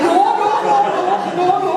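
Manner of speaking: high, loud playful denial; loud mock protest. Mock-protesting to her boss Stephen. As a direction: protest with a big laugh in it.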